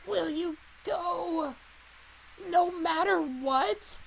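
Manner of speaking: put-on slow, high creaky old voice; grumpy old man. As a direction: ask gravely like an old man. Old woman voice in dub.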